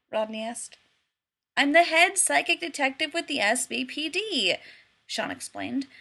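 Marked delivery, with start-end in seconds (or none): 1.6-4.6 grandly self-important; proud boast. A narrator's reading. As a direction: announce it with pride. Shawn boasting as psychic.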